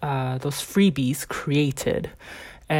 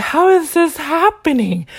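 high, loud, outraged disbelief; re-enacted anger, pitched up and loud; retold anger; 11 semitones higher, 6 dB louder than usual. Venting anger at how this is happening, high and loud retold outrage. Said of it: "I was filled with anger".

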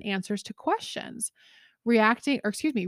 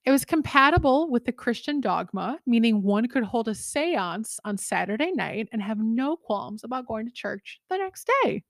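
usual speaking voice

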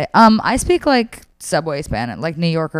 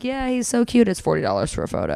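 imitated sales turn; 4 semitones higher, 5 dB quieter than usual. Imitating monkey owner naming his price, cooing then businesslike.

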